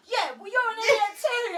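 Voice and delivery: high, loud snapping comeback; angry retort. Retold or imitated. Wife bickering with husband while cycling, high retort. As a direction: fire it back at him. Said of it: "I'm like".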